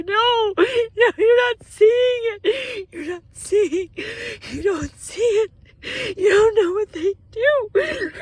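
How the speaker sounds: very high, loud, crying protest; real sobbing shout. Real, not acted. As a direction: sob and shout it out, desperate. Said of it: "burst into tears".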